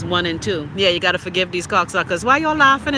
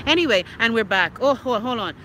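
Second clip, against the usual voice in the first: ordinary delivery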